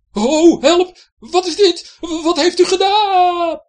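high, loud, flustered yelping; panicked cries. Acted. Pirate slipping on the deck, loud panic. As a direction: cry out while sliding helplessly.

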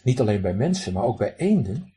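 ordinary delivery, nothing marked

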